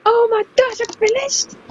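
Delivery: high, loud burst of disbelief; thrilled. Real, not acted. Player who has just finished the game, thrilled. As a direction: cry out in triumph, rising at the end.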